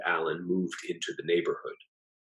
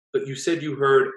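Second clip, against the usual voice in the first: puzzled protest; 8 semitones higher, 5 dB louder than usual. A narrator's reading. Husband protests.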